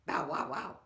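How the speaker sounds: loud mock dog barking; playful woof woof imitation. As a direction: bark it out like a curly dog. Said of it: "he said".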